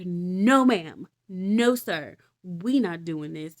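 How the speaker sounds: loud firm no, ma'am; loud rebuke. Acted. Jesus rebuking the Pharisee, loud firm refusal.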